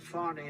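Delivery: Fani as "Fah-nee", a little French touch; French-style FANI. Shown as a demonstration. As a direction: give Fani an open French "ah". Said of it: "a little French accent there".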